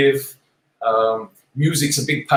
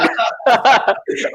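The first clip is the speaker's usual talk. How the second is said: laugh; 10 semitones higher, 4 dB louder than usual. Real, not acted. Laughs at host's struggle.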